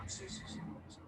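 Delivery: quick hushed yeses; eager whisper. Retold or imitated. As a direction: whisper excitedly, barely breathing the words. Brothers whispering agreement.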